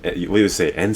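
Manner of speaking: ordinary talk, nothing marked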